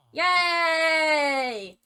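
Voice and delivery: loud, high, long-held cheer; celebratory cheer. Acted. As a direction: stretch it out like a hammed-up hooray. Mock cheer for the royal wedding.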